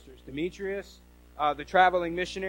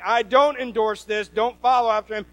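louder, higher, emphatic prohibition; stern command; 4 semitones higher, 8 dB louder than usual. Acted. Church boss warning followers off the missionaries, stern command. Voicing Diotrephes forbidding support.

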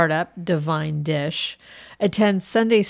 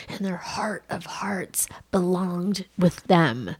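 whisper; 4 dB quieter than usual. Acted. Dramatic aside about true love.

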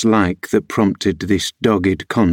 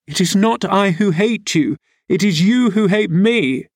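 put-on much higher, tearful girl's voice; weeping; 11 semitones higher than usual. A narrator's reading. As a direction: accuse him through acted tears. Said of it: "wept Cathy, no longer disguising her trouble".

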